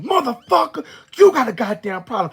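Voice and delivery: furious high-pitched yell; screaming. Retold or imitated. Woman who lost it at her ex in the car, screaming. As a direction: lose it and scream at them full force. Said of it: "screaming at you and scaring you".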